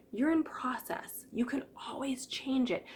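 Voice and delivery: soft whispered reassurance. Real, not acted. Coach soothing a stressed listener, whispered. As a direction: breathe it gently to them.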